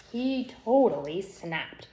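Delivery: plain reading voice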